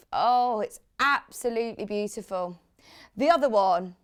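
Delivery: ordinary delivery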